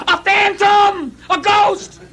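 high, slow panicked cry; terrified scream. Retold or imitated. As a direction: yell as if seeing a ghost. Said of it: "the first thing they scream out is".

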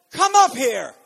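loud, high summons; ringing command from heaven; trumpet-like call. Acted. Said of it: "said to me like a trumpet".

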